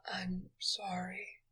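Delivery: faint breathy whisper; whispered apology. A narrator's reading. Weak thief whispers sorry to his queen. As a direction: barely voice the apology.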